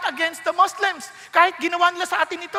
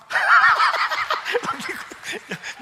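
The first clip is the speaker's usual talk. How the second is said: a light airy laugh; amused laugh; 6 semitones higher than usual. Real, not acted. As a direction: chuckle at your own quip. Laughing after joke.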